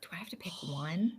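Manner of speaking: whispered aside; breathy unvoiced question. Real, not acted. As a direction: whisper it playfully. Whispered reply to quiz question.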